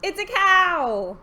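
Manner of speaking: loud, high announcement; excited reveal. Real, not acted. Revealing the cow to children, excited. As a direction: shout the answer gleefully.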